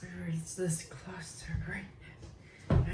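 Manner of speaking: slow, hushed movie-villain quote; drawn-out dramatic whisper; intense villain whisper. Acted. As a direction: whisper it slowly, building to an outburst. Acting out a film quote.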